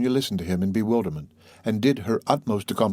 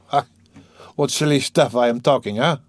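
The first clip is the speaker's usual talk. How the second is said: louder, self-mocking scoff; ironic; 5 semitones higher, 5 dB louder than usual. A narrator's reading. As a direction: scoff at your own nonsense. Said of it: "ironical smile".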